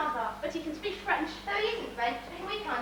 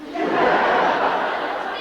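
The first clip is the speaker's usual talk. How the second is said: burst of laughter; 13 dB louder than usual. Acted. Delighted at hearing French.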